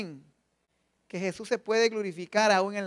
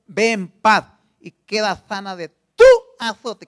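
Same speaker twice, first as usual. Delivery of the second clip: slow, louder, tender blessing; Jesus speaking tenderly; 11 dB louder, slower than usual. Acted. Jesus to the healed woman, slow tender blessing. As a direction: bless her slowly and gently. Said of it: "Y él le dijo".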